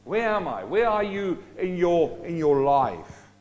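ordinary delivery

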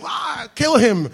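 high, drawn-out mock cry; mock outraged crowd. Imitating offended listeners at the prayer, mock outrage. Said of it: "están los hindúes ahí".

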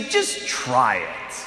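slow, soft, low invitation; gentle and calm. Retold or imitated. Alexander gently inviting conquered people, slow soft voice. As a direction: coax them gently, slowly. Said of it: "very gently, very calmly".

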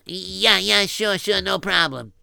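ordinary delivery

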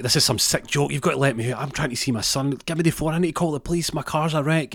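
high, pressing, indignant demands; agitated protest. Acted. Trapped father demands his phone, agitated and high.

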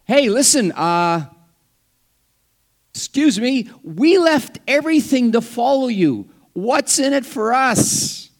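preacher acting out Peter demanding his reward; brash Peter. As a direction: butt in boldly, demanding a payoff. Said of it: "Peter's the one who steps up and says".

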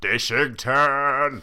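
high, long drawn-out "Dishington!"; sitcom boss shout. Retold or imitated. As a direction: stretch out the name like an exasperated sitcom boss. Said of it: "Proper sitcom style".

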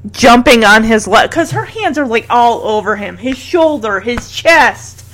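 normal talking voice

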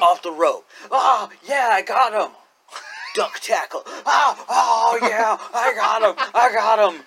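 hammed-up wrestler calling moves mid-grapple; mock wrestler. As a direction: imitate a straining wrestler. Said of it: "the way that the guy does it".

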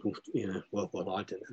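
very soft, hesitant mumbled excuse; sheepish. Retold or imitated. Classmates excusing themselves to the tutor, sheepish. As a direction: play sheepish classmates, voice dropped low in volume. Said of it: "everybody else was looking rather sheepishly".

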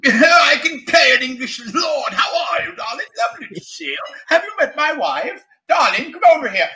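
high, plummy English lord accent. Shown as a demonstration. Actor showing off accents to an interviewer, posh English lord. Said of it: "any other accent pretty much I can do".

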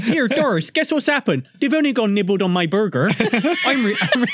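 a hammed-up West Country husband calling Doris; West Country accent. West Country husband tells Doris about his nibbled burger.

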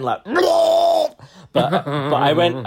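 a sudden squealing laugh into a heave; retching laugh-shout. Retold or imitated. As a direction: burst out in a high laughing cry. Acting out the vomit moment.

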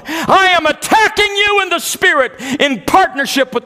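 high, slow, loud rebuke of Satan; desperate rebuke. Retold or imitated. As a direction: command the devil to let go with fierce determination. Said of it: "a sense of desperate determination".

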